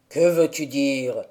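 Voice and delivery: put-on low, rough old man's question; gruff old man. A narrator's reading. Old man answers the child, low and gruff. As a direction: growl it out like a wary old hermit. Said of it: "demanda-t-il d'un ton rude".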